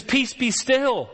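high, slow, ringing command; commanding the storm. Retold or imitated. Jesus turning to the storm, high slow ringing command. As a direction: order the sea to obey. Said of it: "he says".

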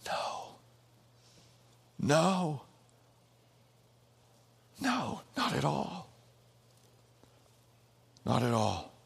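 quiet, settled no; hushed no. Acted. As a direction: say it gently, almost to yourself. Same answer repeated, hushed.

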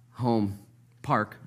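usual speaking voice

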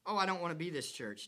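usual speaking voice

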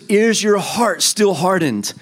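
high, slow pleading question; voice of Jesus. Acted. Jesus questioning the disciples, high slow plea. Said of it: "And he says".

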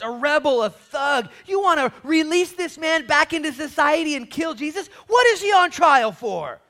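high, loud, building argument; incredulous protest. Acted. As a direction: plead loudly against the crowd's choice. Pilate acted, arguing with the crowd.

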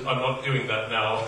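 put-on low, quiet British accent; Patrick Stewart. Star brushing off two pushy fans, British accent. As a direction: dismiss them softly in a put-on British accent. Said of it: "in his most excellent British accent".

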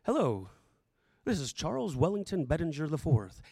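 low, self-important phone voice; mock formal introduction. Dad pretending to introduce himself by phone, mock formal. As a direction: announce a full name pompously, pitched low. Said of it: "he didn't have to say".

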